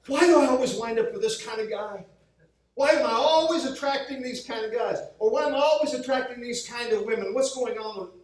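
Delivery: acted exasperated complainer. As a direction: voice someone puzzled by their own bad luck. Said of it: "What about the person who says".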